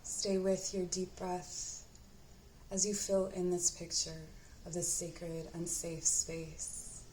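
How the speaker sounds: unhurried, even-toned meditation guide; guided meditation. Acted. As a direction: guide the listener gently inward, leaving space to breathe.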